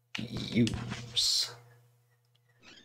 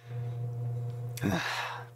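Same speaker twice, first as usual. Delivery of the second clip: theatrical sigh; 7 semitones higher, 8 dB quieter than usual. Acted. Dramatic sigh after lament.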